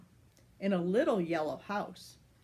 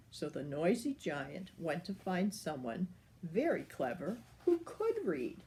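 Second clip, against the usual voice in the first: usual speaking voice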